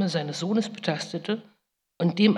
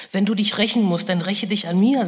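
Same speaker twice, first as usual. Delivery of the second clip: raised, louder pleading; imploring; 4 semitones higher, 8 dB louder than usual. Retold or imitated. Mother imploring her furious son Esau, raised voice. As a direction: beg him to turn his hate on you. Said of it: "beschwor ich ihn".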